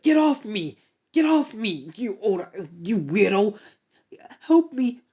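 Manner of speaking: high, frantic shouts to shake her off; panicked yelling. Acted. Cowardly hero kicks at the woman, high panicked yells. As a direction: yell in a blind panic.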